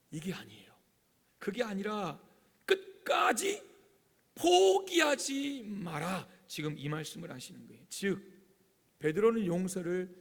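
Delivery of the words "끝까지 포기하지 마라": high, loud, drawn-out exhortation; emphatic command. Acted. Preacher voicing Jesus urging disciples never to give up, emphatic command. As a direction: stretch it out slowly and forcefully.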